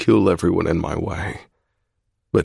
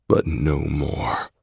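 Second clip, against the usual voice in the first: slow firm resolve; 3 semitones lower, 4 dB quieter, much slower than usual. A narrator's reading. Zai's firm declaration.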